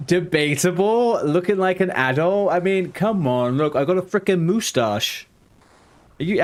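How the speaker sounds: ordinary talk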